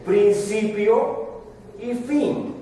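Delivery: "Principio" stretched very slowly and high, then "y fin"; drawn-out proclamation. Acted. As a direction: spell out each word slowly, as God's title. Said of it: "pero ahora dice de otra manera".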